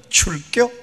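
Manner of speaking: clipped high 출겨 in Chungcheong dialect. Retold or imitated. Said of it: "얼마나 짧고 빠른지 모릅니다". (짧고 높은 충청도 사투리)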